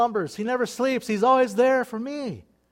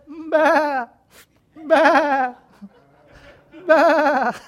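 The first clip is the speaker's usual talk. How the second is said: high, loud laughing baa; sheep bleat; 7 semitones higher, 8 dB louder, much slower than usual. Pretend. Believer calling God like a sheep, laughing baa. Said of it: "just go".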